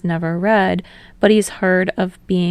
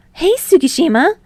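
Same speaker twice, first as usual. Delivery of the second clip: high, louder, bouncy call of hello; bright eager greeting; 10 semitones higher, 5 dB louder than usual. Acted. Hinata greeting Tsukishima on entering the shop, high and cheerful. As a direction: call out his name brightly.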